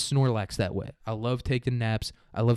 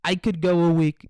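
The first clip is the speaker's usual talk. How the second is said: higher, boastful mock Trump brag; Trump impression; 5 semitones higher than usual. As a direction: puff up and brag like Trump at a rally. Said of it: "that's what Trump's gonna come back with".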